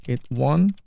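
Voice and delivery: normal talking voice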